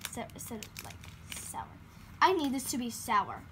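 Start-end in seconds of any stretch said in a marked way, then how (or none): 0.1-1.6 unvoiced whisper aside. Real, not acted. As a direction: whisper it to yourself. Aside about the sour fries.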